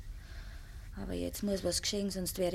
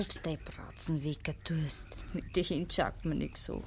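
whisper; 3 semitones lower, 3 dB quieter than usual. Acted. Nächtliches Flüstern in Kammer.

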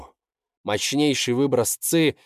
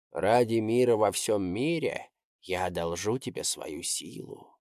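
ghostly echoing voice; 4 dB quieter than usual. Acted. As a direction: speak as a voice inside his head. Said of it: "голос эхом прозвучал в голове".

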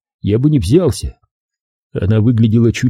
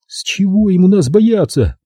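high-pitched, indignant challenge; indignant, high question; angry captive woman; 9 semitones higher than usual. A narrator's reading. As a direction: demand it in a raised woman's voice. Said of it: "Она кипела от гнева".

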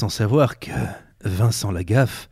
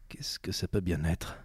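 whisper; 3 semitones lower, 8 dB quieter than usual. Acted. Detective puzzling over a CD.

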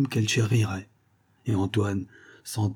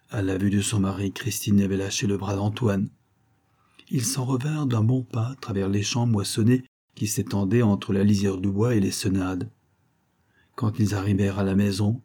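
ordinary delivery

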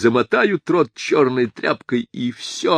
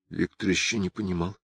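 ordinary delivery

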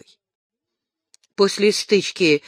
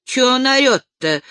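ordinary delivery, nothing marked

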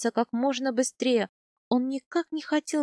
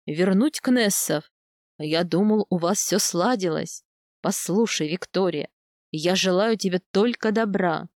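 lower, steady voice of a kind steppe khan; khan's voice; 5 semitones lower than usual. A narrator's reading. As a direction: speak low and earnest as the old khan. Khan's long speech. (пониженный голос хана, серьёзно и по-доброму)

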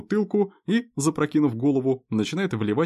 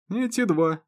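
ordinary delivery

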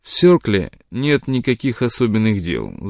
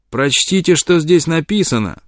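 higher, heated indignant demand; angry; 7 semitones higher than usual. Acted. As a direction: shove the paper at him angrily. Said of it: "Разгневанный Джон Мелтон".

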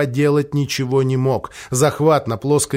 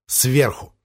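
ordinary delivery

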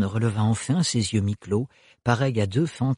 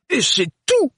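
put-on much higher, sharp indignant old man; growling great-uncle; 13 semitones higher than usual. A narrator's reading. The great-uncle scolding Thorne, much higher and angry. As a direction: grumble it in anger. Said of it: "gronda le grand-oncle".

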